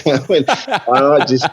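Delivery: giggling through the words; can't hold the laugh in. Real, not acted. Telling the story of the headmaster, laughing.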